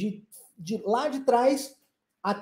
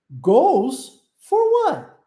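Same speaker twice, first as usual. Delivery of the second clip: slow, high and loud, falling on what; slow model question; 5 semitones higher, 6 dB louder, much slower than usual. Shown as a demonstration. Teacher models the question for students to repeat. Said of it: "Vamos repetir essa".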